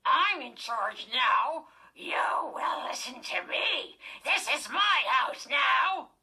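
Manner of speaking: slow, loud, raspy dummy growl; possessed dummy snarling that he's in charge; raspy deep rumble. A narrator's reading. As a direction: claim the house like a rasping tyrant. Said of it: "raspy, deep rumble of a voice".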